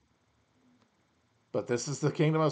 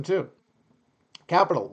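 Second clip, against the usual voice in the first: ordinary delivery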